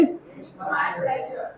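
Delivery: slow whispered model phrase; hushed, slow drill line. Shown as a demonstration. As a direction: whisper it, drawn out, for the class to copy. Teacher repeats model phrase.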